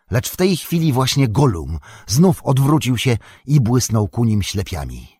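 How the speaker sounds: ordinary delivery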